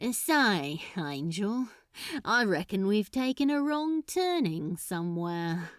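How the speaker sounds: lightly voiced, thoughtful and slightly drunk; musing Crowley. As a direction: muse aloud, half to yourself. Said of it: "said Crowley musingly".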